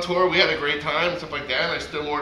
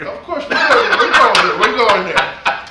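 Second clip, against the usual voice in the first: loud, laughing agreement; real laugh running into loud words; laughing assent; 6 semitones higher, 10 dB louder than usual. Real, not acted. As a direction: burst out laughing and agree loudly. Laughing assent to tell story.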